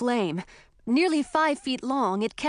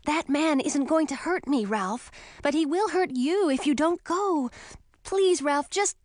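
pleading Emma; 5 semitones higher than usual. A narrator's reading.

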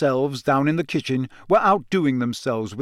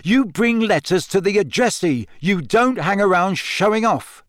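higher, heated telling-off; irritated, raised-pitch reprimand; cross scolding; 6 semitones higher, 4 dB louder than usual. A narrator's reading. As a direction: scold the pet emphatically. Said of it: "Ron hissed".

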